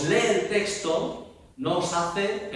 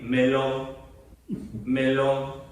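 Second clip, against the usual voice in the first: "melón" said flat and mechanical, like an automaton; dead neutral "melón", like a robot; robotic neutral; much slower than usual. Shown as a demonstration. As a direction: drop all meaning from "melón". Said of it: "No soy un autómata, no soy un robot".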